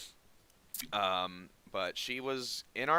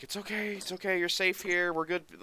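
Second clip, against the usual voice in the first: reassuring; 9 semitones higher than usual. Retold or imitated. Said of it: "and I'm just like".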